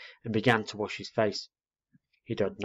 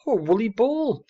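put-on high, louder little-girl exclamation; delighted cry; 14 semitones higher, 5 dB louder than usual. A narrator's reading. Little girl spotting a fluffy ball at her feet, delighted cry. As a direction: squeal with delighted discovery. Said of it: "cried the little girl".

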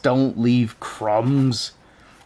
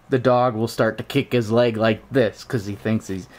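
ordinary delivery

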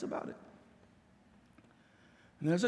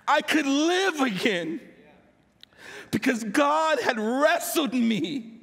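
louder, higher proclamation with a laugh at the end; triumphant preaching; 5 semitones higher, 4 dB louder than usual. Acted. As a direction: proclaim it like a victory. Triumphant climax of the testimony.

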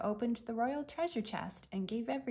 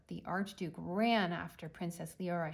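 ordinary delivery, nothing marked

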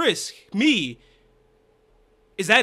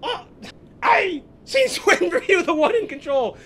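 much higher, louder squeaky mockery, laughing through it; mocking Chara; 11 semitones higher, 6 dB louder than usual. Player mocking Chara's line, squealing with laughter. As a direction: lampoon the villain's boast. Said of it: "Chara's all like".

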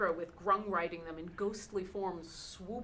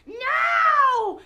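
high, loud, horrified scream of no; a creature's dying cry of denial; horrified angry shout; 13 dB louder, much slower than usual. Acted. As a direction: scream it in horror and rage. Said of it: "horrified and angry".

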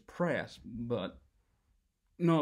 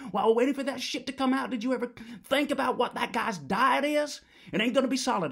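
louder, higher, faster disgusted ranting; worked-up rant; 6 semitones higher, 3 dB louder than usual. Acted. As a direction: whip yourself into a frenzy.

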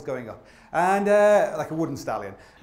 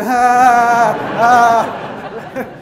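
triumphant roar; 6 semitones higher, 13 dB louder than usual. Retold or imitated. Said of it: "I was like".

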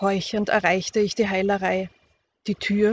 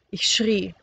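ordinary delivery, nothing marked

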